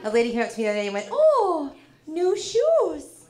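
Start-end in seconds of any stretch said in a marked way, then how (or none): 1.1-3.2 high, drawn-out mock-polite judgement; subtle meanness. Minnesota lady on new shoes, politely mean. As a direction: imitate a politely catty stranger, pitched high. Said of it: "mean in a way you almost can't even put your finger on".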